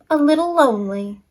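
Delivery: plain reading voice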